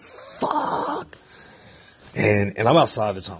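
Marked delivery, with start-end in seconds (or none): 0.0-1.2 hushed, voiceless mock scream; retold scream. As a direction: imitate a long scream without the volume. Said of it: "and I screamed".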